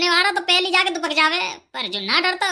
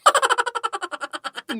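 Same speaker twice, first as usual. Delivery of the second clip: high laugh; 7 semitones higher than usual. Acted. Laughs at friend's teasing.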